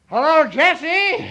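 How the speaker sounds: loud, high, excited hello; shouting into phone. Acted. Johnny greeting Jessie over long distance, shouting. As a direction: holler down a long-distance line. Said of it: "you don't have to shout so loud".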